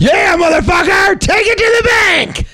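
very high, loud falsetto yell; falsetto hype shout. Acted. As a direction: scream it high like a hype man.

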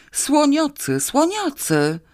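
high, panicked roar; terrible roar. A narrator's reading. Drunk man seeing a dancing pink elephant, roaring in terror. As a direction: roar it out in mounting alarm. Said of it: "ryknął nagle okropnie".

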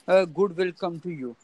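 ordinary talk, nothing marked

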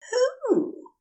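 put-on a giddy angel drunk on venom, gushing; tipsy Aziraphale. Befuddled Aziraphale.

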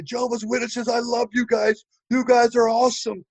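high-pitched gushing welcome; overly friendly greeting. Retold or imitated. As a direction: greet them like long-lost friends.

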